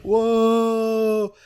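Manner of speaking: drawn-out, held crowd whoa; crowd reaction. Retold or imitated. Audience reacting to the wrestler's challenge, imitated long whoa. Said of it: "everybody's like".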